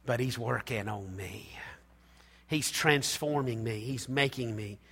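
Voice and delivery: hushed whisper of reassurance; a quiet, breathy confession. Real, not acted. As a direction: whisper it like a secret. Intimate aside in sermon.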